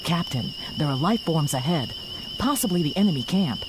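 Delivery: low, soft report; hushed warning. Acted. Crew member warning captain of life forms ahead, hushed and low. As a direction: keep it down near the enemy. Scout quietly warns captain of enemy.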